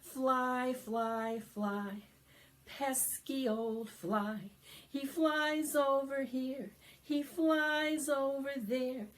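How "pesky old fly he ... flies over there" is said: normal talking voice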